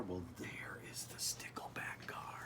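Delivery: hushed whisper, as if not to scare the fish; secretive storybook whisper. Acted. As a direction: whisper it so the shy fish stays put. Pointing out the shy fish.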